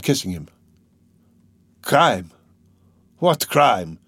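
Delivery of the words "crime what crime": indignant, emphatic outburst; flaring, incredulous anger; sudden fury. A narrator's reading. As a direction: throw the word back angrily. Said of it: "he cried in sudden fury".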